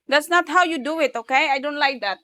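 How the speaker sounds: loud, high-pitched reproach; parental telling-off. Pretend. Parent disapproving of child's way, loud scolding.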